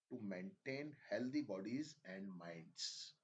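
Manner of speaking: slow, deliberate passage reading, phrase by phrase; dictation-style reading. Shown as a demonstration. Teacher reads a passage for students' listening practice, measured dictation. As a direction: read it out slowly with long pauses between phrases.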